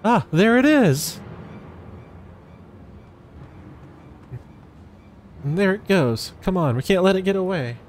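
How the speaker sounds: thrilled spotting the target; hammed-up excitement; excited high call. Spotting the flying object.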